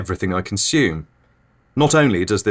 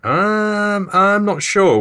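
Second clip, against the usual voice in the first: high, thrown-off hesitation; startled host voice; taken aback; 12 semitones higher, much slower than usual. Acted. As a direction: caught off guard, voice jumps up. Host thrown by odd guest.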